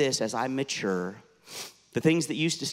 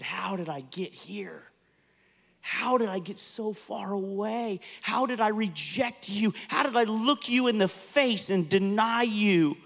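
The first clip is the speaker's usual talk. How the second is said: retold weeping prayer; 7 semitones higher than usual. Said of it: "I was weeping before the Lord".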